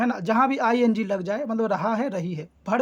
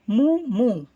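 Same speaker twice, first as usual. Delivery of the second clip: cow moo; 3 semitones higher, 4 dB louder than usual. Acted. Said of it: "यह गाय की आवाज़ से moo".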